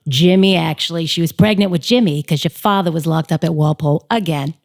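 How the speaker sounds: put-on thick Southie accent, a performed line from the play; broad South Boston accent, strong and theatrical. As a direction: deliver it as a Boston local telling a story. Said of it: "would you like to hear a line from the play?".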